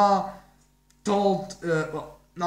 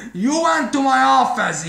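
loud, higher-pitched retold yell; mock scream; 6 semitones higher, 8 dB louder than usual. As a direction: act out an angry scream, louder and higher. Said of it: "basically he's screaming".

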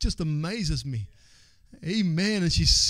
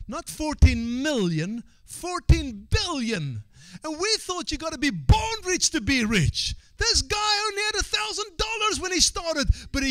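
climbing, incredulous pulpit cry; impassioned preaching; 10 semitones higher than usual. Real, not acted. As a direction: preach it with rising amazement. Preaches at rising force.

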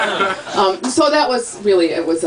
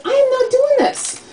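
high, emphatic retold protest; indignant refusal. Sister refusing her brother's grocery errand, indignant. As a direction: dig heels in, pitched way up.

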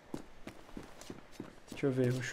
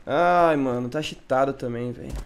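exasperated; 8 dB louder, slower than usual. Real, not acted. Accusing cheater.

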